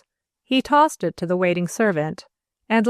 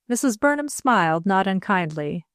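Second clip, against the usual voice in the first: ordinary delivery, nothing marked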